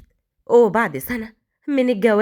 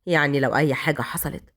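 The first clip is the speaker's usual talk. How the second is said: low, teasing, menacing questions; mocking hero; 8 semitones lower than usual. A narrator's reading. Raad mocking Malak's refusal, low and menacing. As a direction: toy with her objection.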